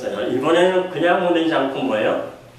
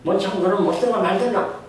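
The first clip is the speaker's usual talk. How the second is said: higher, fed-up give-in; exasperated parent; 6 semitones higher than usual. Retold or imitated. Father imitating himself giving up on his son, higher fed-up shrug. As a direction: throw up your hands, whatever. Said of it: "저는 나중에 뭐라 그래요".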